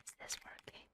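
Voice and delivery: breathy close-mic whisper; ASMR whisper. Pretend. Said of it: "talk really quietly".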